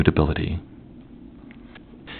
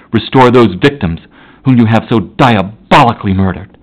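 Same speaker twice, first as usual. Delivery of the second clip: loud, raised, contemptuous tirade; furious detestation; 3 semitones higher, 4 dB louder than usual. Acted. Victor Frankenstein confronting his creature on the glacier, furious and loud. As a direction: hurl every word at the monster in fury. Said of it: "words expressive of furious detestation and contempt".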